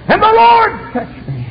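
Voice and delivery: high, slow preacher's shout; loud, drawn-out cry of testimony; exultant testimony. Retold or imitated. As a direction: holler it out as praise.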